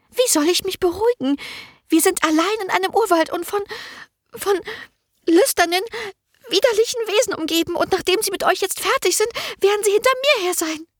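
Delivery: hohe, panische Stimme, sich hineinsteigernd; aufgeregte, ängstliche Magd. A narrator's reading. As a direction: hektisch und empört, immer aufgeregter. Merle voiced high, panicky. (Merle redet sich vor Anna in Panik)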